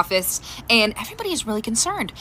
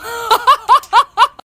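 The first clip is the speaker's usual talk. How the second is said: a loud, high burst of laughter; real laugh; 9 semitones higher, 6 dB louder than usual. Real, not acted. Mom laughing after telling her daughter story. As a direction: crack up at the punchline.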